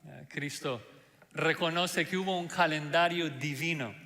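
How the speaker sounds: ordinary talk, nothing marked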